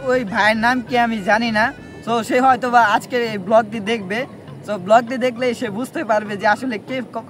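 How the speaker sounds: ordinary talk, nothing marked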